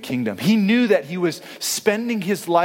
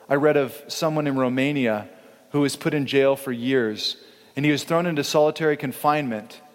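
ordinary delivery

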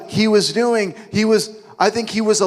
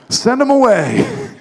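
much higher, louder, slow mock complaint; mock disciple; 7 semitones higher, 4 dB louder than usual. Preacher mocking the disciples' favorite line, slow whiny. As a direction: dismiss them with a sing-song whine. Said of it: "disciples' favorite phrases".

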